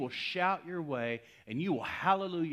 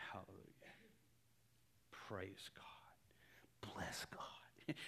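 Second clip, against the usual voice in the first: barely audible, whispered praise; very soft, tentative imitation of praying; hushed praise; 4 semitones lower, 21 dB quieter, slower than usual. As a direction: start praising under the breath. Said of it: "he starts to say".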